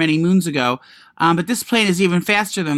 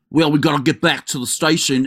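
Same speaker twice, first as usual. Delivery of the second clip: mocking Boston-accented detective; bad Boston accent. Co-host mimicking an actress playing a detective, bad Boston accent. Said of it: "she has this fucking Boston accent".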